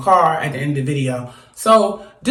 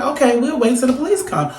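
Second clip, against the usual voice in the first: mock cheerful agreement; 8 semitones higher than usual. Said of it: "So I'm like".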